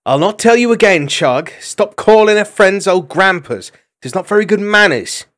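high, loud, prim scolding; a young hedgehog maid telling off a cheeky youngster. A narrator's reading. Trimp chides Chugger.

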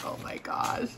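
breathless whispered gasp; awed whisper. Real, not acted. Vlogger awed by a gift, whispering softly. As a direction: whisper it in awe.

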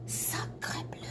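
whispered mock French accent; terrible French accent. Artist jokingly swears in a terrible whispered French accent. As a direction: breathe a bad French accent oath. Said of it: "That was my terrible French".

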